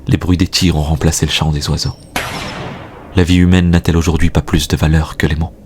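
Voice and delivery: normal talking voice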